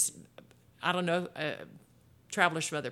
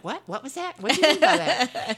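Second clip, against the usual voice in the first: imitating baffled coworkers; 8 semitones higher, 7 dB louder than usual. Imitating puzzled northern coworkers.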